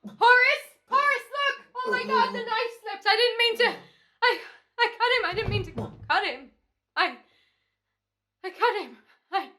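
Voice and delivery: high, very loud frantic panic; panicked. Acted. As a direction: babble in horror at what you did. Killer panics after accidental stabbing.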